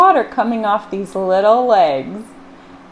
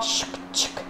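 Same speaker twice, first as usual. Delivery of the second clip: soft, whispered shk shk; 12 dB quieter than usual. Acted. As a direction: whisper the scrape. Washboard sound again.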